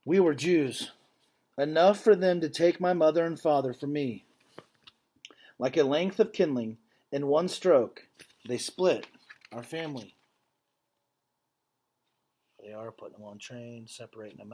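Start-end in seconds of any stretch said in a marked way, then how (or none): 0.1-10.2 earnest, measured read-aloud voice; story narration. A narrator's reading. Teacher reading a picture book to students, earnest storytelling. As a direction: read the story to the class with feeling.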